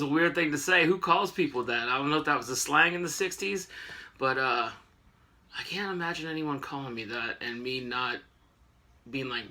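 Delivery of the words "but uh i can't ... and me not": normal talking voice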